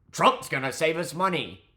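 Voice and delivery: taunting imitation of a Trump fan; mocking voter. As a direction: parrot them like a naive believer. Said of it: "mocking Trump voters' claim".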